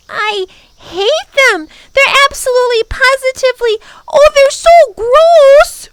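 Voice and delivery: put-on very high, loud little-girl disgust; through clenched teeth. A narrator's reading. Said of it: "said through clenched teeth".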